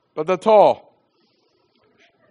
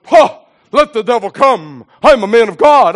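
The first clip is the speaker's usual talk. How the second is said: mock bravado; 4 semitones higher, 9 dB louder than usual. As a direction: play the fearless man of God, half joking. Mock bravado of a man of God.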